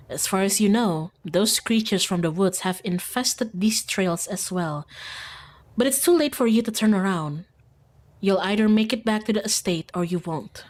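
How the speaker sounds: even, measured storyteller read; story narration. Acted. Streamer reading the narration of the dash home, measured. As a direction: narrate the danger steadily.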